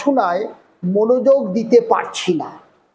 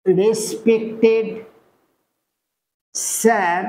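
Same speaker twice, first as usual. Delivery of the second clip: slow dictation again; much slower than usual. Shown as a demonstration. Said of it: "তাহলে আমরা এখানে কি লিখতে পারি?".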